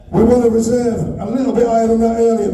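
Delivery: ordinary talk, nothing marked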